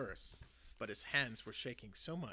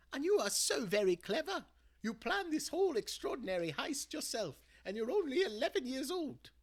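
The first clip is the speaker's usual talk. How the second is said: higher, louder loving grandmother's voice; reassuring granny; 12 semitones higher, 8 dB louder than usual. Acted. Granny hugging her grandson and reassuring him, tender. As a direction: comfort him like a proud granny.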